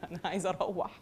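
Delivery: low, soft bored complaint; mock exhausted audience. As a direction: groan quietly like a listener who has had enough. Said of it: "you'd die".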